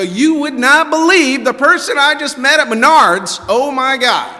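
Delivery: high, bursting with news; gushing about a new friend; breathless excited storytelling. Retold or imitated. Self quoted, excited about meeting someone.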